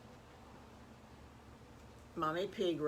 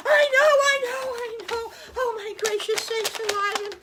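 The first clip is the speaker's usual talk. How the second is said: high, loud squealing piglet voice; excited little pig, way up high and loud; overexcited piglets; 11 dB louder than usual. Acted. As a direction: squeal like kids bursting with news. Piglets excited about the circus.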